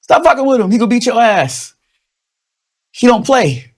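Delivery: high-pitched scolding warning. Retold or imitated. Comic telling Trump voters he warned them. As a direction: warn them up high, insistent. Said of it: "We told you".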